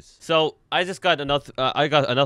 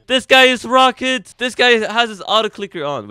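high, loud hype call; excited yelling; 9 semitones higher, 8 dB louder than usual. Real, not acted. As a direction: shout it out amazed.

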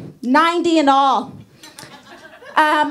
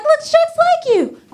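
mom voice; 13 semitones higher than usual. Retold or imitated. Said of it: "My mom was like".

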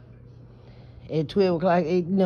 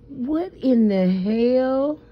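slow, high, drawn-out disbelief; shocked disbelief; 8 semitones higher, much slower than usual. Retold or imitated. Vlogger recalling first seeing the video, stunned. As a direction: stretch it out in stunned horror. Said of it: "I was like".

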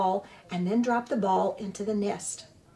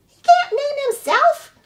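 high, loud squawking goose voice; squawking old goose; 10 dB louder than usual. Acted. Old goose squawks at the robot about the orphaned hatchling, high and loud. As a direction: scold like an indignant old goose.

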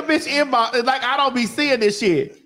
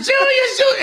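high fan shriek of the name; screaming crowd; 14 semitones higher, 4 dB louder than usual. Retold or imitated.

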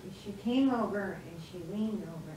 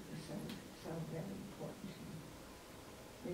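whispered; 9 dB quieter than usual. Real, not acted. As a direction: let the words fall to a hushed whisper. Emotional testimony to audience.

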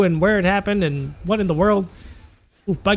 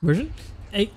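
ordinary delivery

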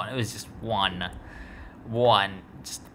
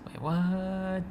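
high squeaky disbelieving what; can't believe what you just heard; shocked disbelief; 9 semitones higher, much slower than usual. Real, not acted. Shocked at Templars news.

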